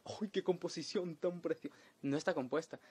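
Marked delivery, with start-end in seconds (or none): 0.1-1.8 lightly voiced gushing fan; a touch of an impressed listener; mock admiring voice. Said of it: "cualquier persona diría".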